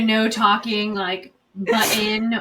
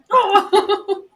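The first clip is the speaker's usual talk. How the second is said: laughing oh; 11 semitones higher, 8 dB louder than usual. Real, not acted. Retold deflated reply.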